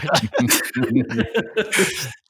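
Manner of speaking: trailing villain chuckle; evil laugh. Pretend. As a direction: let the cackle wind down. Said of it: "your best evil laugh?".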